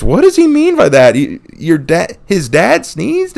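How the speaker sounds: high, then slow halting disbelief; puzzled teacher spelling it out; baffled teacher. Retold or imitated. Teacher asking parents about the sneeze, slow baffled.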